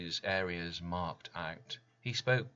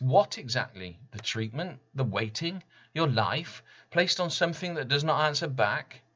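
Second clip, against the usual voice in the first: agitated questions; 6 semitones higher, 5 dB louder than usual. Retold or imitated. Patient questions fellow patient urgently.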